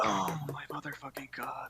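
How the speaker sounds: hissed whisper into the mic; breathy whispered curse; quiet voice loud hiss. Real, not acted. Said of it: "when you're this quiet".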